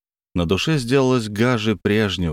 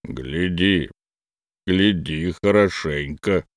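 low slow grumble; grumbling; 3 semitones lower, slower than usual. A narrator's reading. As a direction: grumble it bitterly. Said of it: "проворчал Мюнстер".

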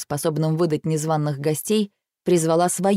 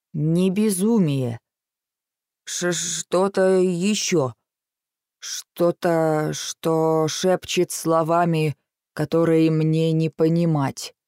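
put-on slow, halting Irish-accented fey; eerie, dragging Irish brogue; much slower than usual. Possessed fey whispers slowly.

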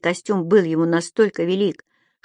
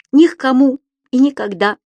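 emphatic; 6 semitones higher, 6 dB louder than usual. A narrator's reading. Subastik repeats firmly.

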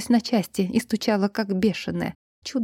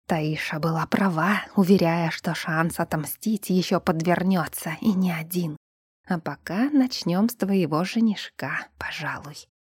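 sinister whisper; 2 semitones lower, 4 dB quieter than usual. Acted. Spirit's inner gloating thoughts.

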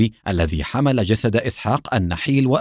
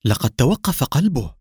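understated panic, narrated rather than shouted; alarmed cry; 3 semitones higher than usual. A narrator's reading. Doctor listening at the patient's chest, mildly panicked cry. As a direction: announce it with a touch of dread. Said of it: "وقد ملأه الذعر وهو يصيح".

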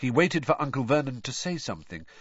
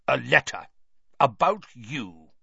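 Uncle Vernon voice; 4 dB louder than usual. A narrator's reading. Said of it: "said Uncle Vernon".

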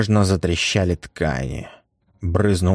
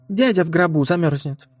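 put-on mother to child; 10 semitones higher than usual. Mother answers son.

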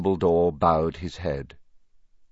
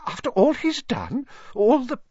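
high, distressed disbelief; faltering; 3 dB louder than usual. A narrator's reading. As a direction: protest in shock, pitched very high. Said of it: "faltered Professor McGonagall".

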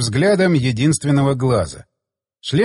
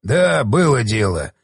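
low, slow and self-satisfied; lowered, drawled wry confession; amused admission; 5 semitones lower, slower than usual. A narrator's reading. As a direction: own up slowly with a smirk. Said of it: "тот вдруг усмехнулся".

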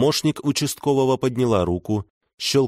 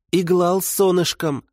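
put-on much higher, blurted toddler lisp; 10 semitones higher than usual. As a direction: burst out like an overexcited little kid. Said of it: "выпалил он громко".

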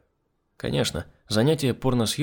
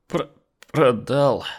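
put-on stuttering the first sound, drunk; drunken stutter; 3 semitones higher, slower than usual. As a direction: stumble over the word. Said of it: "прозаикался Сергей".

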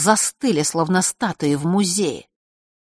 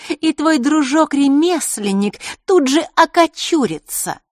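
put-on menacing girl; 10 semitones higher, 6 dB louder than usual. A narrator's reading. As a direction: threaten him with a sure aim. Маришка threatens with arrow.

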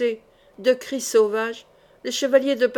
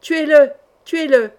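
loud, raised, fierce cry; shouted order to kill; 4 semitones higher, 8 dB louder than usual. A narrator's reading. Bloodied enemies want the knight killed, loud shout. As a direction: yell for his death. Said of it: "crièrent Hoquetonville".

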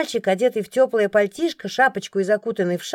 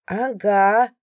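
lightly voiced old woman chiming in; a hint of grumbling granny; elderly woman agreeing; 2 semitones higher, much slower than usual. A narrator's reading. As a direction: chime in like a disgruntled old lady. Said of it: "поддакнула другая, судя по голосу, пожилая".

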